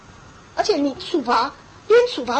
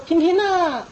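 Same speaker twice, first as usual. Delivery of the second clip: 楼下喊人; 5 semitones higher, 4 dB louder, slower than usual. Retold or imitated. 父亲在楼下喊孩子.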